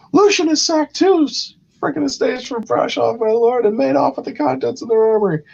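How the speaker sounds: high, far put-on voice, rushed in the middle; a fussy courtier breaking bad news; mock councillor report. Council member reporting raids to his lord, high put-on voice.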